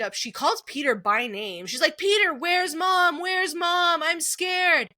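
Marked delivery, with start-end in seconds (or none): 2.0-4.9 imitated frightened child calling out, high and loud; drawn-out mock panicked wail for a parent; [name], worked up. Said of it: "getting more and more worked up".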